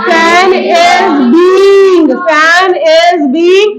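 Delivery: drawn out, slow sing-song; very slow dictation. Shown as a demonstration. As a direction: stretch it out for the class to repeat. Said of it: "teacher dictating for class".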